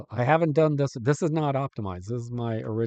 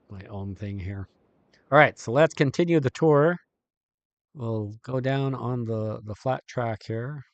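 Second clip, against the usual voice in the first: ordinary delivery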